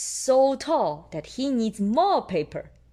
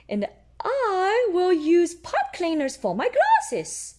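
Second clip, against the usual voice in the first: higher, slow character voice; slow, high child voice telling the class his plan; Pedro's voice; 9 semitones higher, 4 dB louder than usual. Acted. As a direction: voice a little pony with a plan. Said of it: "Pedro Pony says".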